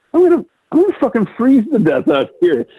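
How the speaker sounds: laughing at own plight. Retold or imitated. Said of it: "And I'm laughing to myself".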